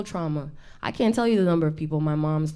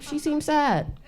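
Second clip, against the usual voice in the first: much higher pitying voice; mock audience; 10 semitones higher, slower than usual. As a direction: play the audience pitying her. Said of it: "You're like".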